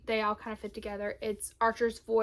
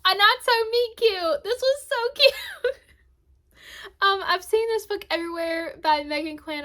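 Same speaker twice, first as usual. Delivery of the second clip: much higher and louder, bursting with glee; excited; 9 semitones higher, 10 dB louder than usual. Real, not acted. Switching to a cute romance after almost crying, excited squeal. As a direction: squeal the title like pure delight.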